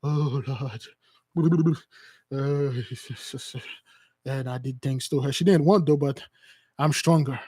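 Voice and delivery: low, hammed-up remorseful mumbling; halting low mock-penitent confession. Caught man admitting a moment of weakness, hammed-up penitence. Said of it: "those people will come up and said".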